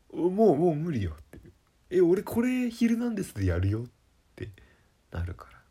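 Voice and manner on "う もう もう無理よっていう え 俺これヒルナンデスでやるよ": higher, louder mock despair; exasperated outburst. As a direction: cry out in exasperation, pitched up. Imagined breakdown in shop.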